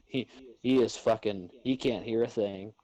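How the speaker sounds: ordinary talk, nothing marked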